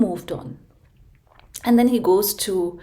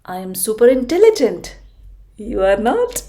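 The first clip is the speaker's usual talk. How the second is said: louder, sneering clever fox; smug fox; 3 semitones higher, 8 dB louder than usual. Acted. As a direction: lord it over him as the fox. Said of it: "the fox said that".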